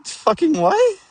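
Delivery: high, incredulous yelp of disbelief; stunned disbelief. Retold or imitated. New DM hearing the story, stunned high yelp. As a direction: shoot way up in pitch, can't believe it. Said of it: "she stared at us".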